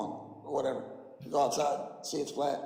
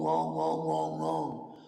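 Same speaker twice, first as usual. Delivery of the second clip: repeated wrongs, mocking and a bit louder, dropping low; mock dismissive wrongs; 9 semitones lower, 3 dB louder, much slower than usual. Streamer parodying globe believers' replies, mocking repeated wrongs. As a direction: parody a stubborn debater saying wrong over and over. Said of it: "this is how it goes".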